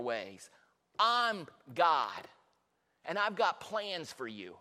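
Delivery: slow, low, measured declaration; grave, deliberate divine pronouncement; solemn voice of God. Acted. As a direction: weigh each phrase with long pauses. Voices God declaring, after the outburst.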